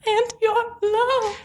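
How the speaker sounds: high, drawn-out lovesick parody; mock pining. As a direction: ham up the sappy line. Repeats worst line in mockery.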